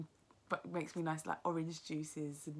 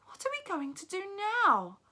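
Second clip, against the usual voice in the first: put-on much higher child's voice, tearful question; young boy; 11 dB louder than usual. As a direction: play the orphaned boy pleading. Boy asks through tears.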